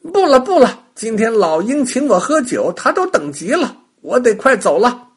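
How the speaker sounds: high, loud quick excuse; bunny in a hurry. A narrator's reading. Rabbit refuses the witch's lunch, high and loud. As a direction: rush out the refusal.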